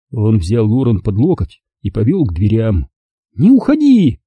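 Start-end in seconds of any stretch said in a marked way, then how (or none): 3.3-4.2 high cry; shouted plea. Acted. Mother cries after Lauren leaving, high. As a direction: call after her. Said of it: "крикнула мама".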